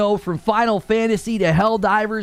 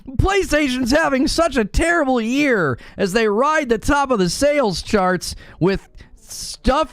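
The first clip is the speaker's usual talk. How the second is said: higher mock-tragic complaint, slowing at the end; sarcastic lament; 7 semitones higher than usual. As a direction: pretend to mourn a huge success. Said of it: "I'm enjoying the sarcasm".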